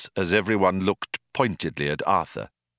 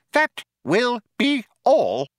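high firm mouse voice; 4 dB louder, slower than usual. Acted. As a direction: shut him down firmly. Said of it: "said Benji firmly".

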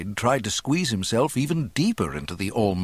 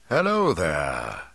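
slow, lower-pitched friendly call; cheerful old man; 3 semitones lower, slower than usual. A narrator's reading. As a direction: hail a stranger cheerfully in an old man's voice. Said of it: "he called in a deeply, surprisingly cheerful voice".

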